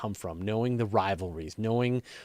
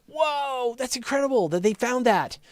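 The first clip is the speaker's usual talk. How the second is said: amazed whoa; 11 semitones higher, 4 dB louder than usual. Retold or imitated. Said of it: "I'm like".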